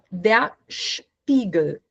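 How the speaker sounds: der Spiegel said slowly, SCH-p; slow German Spiegel. Shown as a demonstration. Teacher slowly reading Spiegel to students. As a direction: stretch out Spiegel. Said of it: "Também um S mudo aqui".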